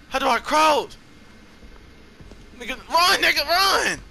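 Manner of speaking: losing it as the chase starts; high panicked shouting; frantic. Real, not acted. Teammate caught, frantic.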